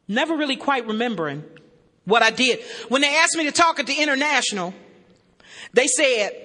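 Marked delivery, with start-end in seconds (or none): none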